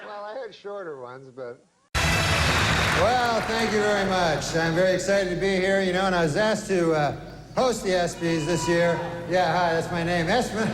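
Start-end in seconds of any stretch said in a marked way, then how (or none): 1.9-10.7 loud, brash stand-up host working the crowd; awards host monologue. Acted. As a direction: play to the room like an awards show host. Stand-up host monologue, louder, announcer.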